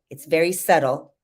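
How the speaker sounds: ordinary delivery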